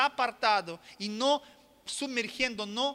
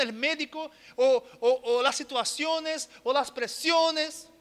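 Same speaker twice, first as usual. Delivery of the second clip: higher, slowing, insistent preaching; building sermon; 5 semitones higher, 3 dB louder than usual. Acted. Preacher pleading with the congregation, building preaching. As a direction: build the plea up, slowing on each item.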